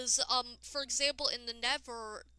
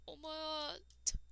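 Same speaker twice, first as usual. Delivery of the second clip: slow, hushed awe; soft awed voice; 4 semitones higher, 10 dB quieter, slower than usual. Retold or imitated. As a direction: breathe it out in wonder. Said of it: "I'm like".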